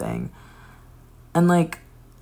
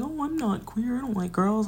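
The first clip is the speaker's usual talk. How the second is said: higher, softer imitation of the friend protesting; mock denial; 8 semitones higher, 5 dB quieter than usual. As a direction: lightly play the friend insisting she's straight. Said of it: "And she was like".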